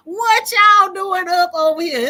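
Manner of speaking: playful disbelief through laughter; mock surprise.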